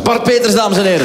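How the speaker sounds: high, excited guest announcement; rousing announcer send-off. Real, not acted. Radio host thanking the musical guest, rousing announcement. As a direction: call for applause, pitch soaring.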